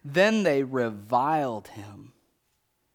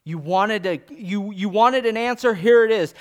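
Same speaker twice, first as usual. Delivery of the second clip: bold challenge; 4 semitones higher, 7 dB louder than usual. Retold or imitated. Bold beggar challenging the leaders.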